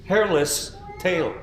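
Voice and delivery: plain reading voice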